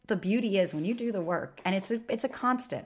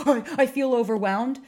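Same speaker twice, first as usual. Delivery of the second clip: gasp, then a higher, louder mock panic; 5 semitones higher, 5 dB louder than usual. To the ex, a mocking gasp and panicked whine. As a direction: parody his overwhelmed panic with a gasp. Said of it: "you're freaking out".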